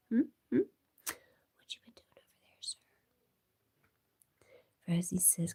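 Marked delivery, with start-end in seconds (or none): 0.1-2.8 pet talk. Real, not acted. Streamer asks her cat where he has been, whispered pet talk.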